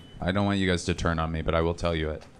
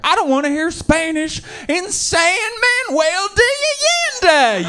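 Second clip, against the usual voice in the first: mock angry yell; 5 dB louder than usual. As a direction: yell it, tripping over the Spanish name. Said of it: "he'd be like".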